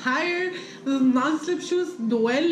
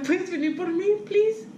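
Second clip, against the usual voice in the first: higher, mock-pitiful plea; whiny little request, rising on please; retold pleading; 5 semitones higher, slower than usual. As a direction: beg a sibling for a favor, playfully pitiful. Retold plea to sister for pickup.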